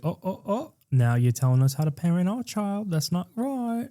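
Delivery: high, soft affronted whine; mock offended host. James McAvoy scolding the guests about parenting. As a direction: act wounded and indignant. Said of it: "James McAvoy's like".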